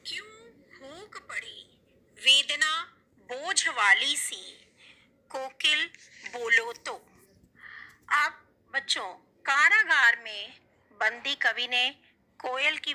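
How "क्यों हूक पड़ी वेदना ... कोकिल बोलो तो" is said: slow, soft, drawn-out recital of the verse; poem recitation. Acted. Teacher reciting the poem to pupils, slow and soft. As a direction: let each line of the poem hang, slowly and quietly.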